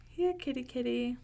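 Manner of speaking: high sing-song call to the cats; calling kitty. Acted. Allah calling his hungry cats, high coaxing call. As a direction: lilt it up like calling cats home.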